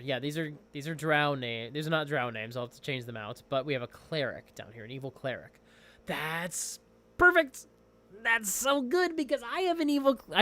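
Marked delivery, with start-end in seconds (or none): 6.1-10.4 delighted. Real, not acted. Delighted by module find.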